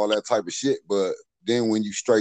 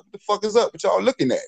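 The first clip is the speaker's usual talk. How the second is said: much higher-pitched retold challenge; confrontational challenge; 10 semitones higher, faster than usual. Alpha friend challenging people staring at them, acted confrontation. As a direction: reenact a friend picking a fight.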